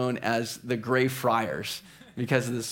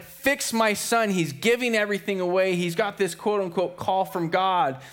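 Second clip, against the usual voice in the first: higher, urgent complaint; exasperated father; 6 semitones higher than usual. Acted. Father complaining to the bishop about his son, exasperated. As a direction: plead for someone to fix him.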